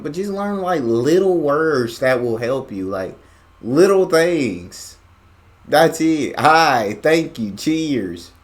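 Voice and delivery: emphatic. Real, not acted. Emphatic advice, loud and high.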